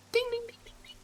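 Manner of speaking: high ringing ding ding; bell imitation. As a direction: sing the shop bell's chime.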